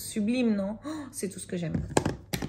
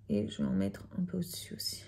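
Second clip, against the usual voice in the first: whispering; 12 semitones lower, 10 dB quieter, faster than usual. Real, not acted. Aside while applying eyeshadow.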